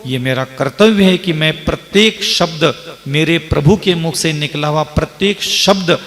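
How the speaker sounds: normal talking voice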